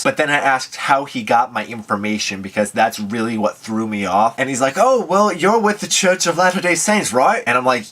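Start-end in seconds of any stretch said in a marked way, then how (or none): 4.8-7.4 the Australian operator, brightly dodging the question; mock Australian accent. Operator dodging how he got the info, mock Australian accent. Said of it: "he's got this Australian accent".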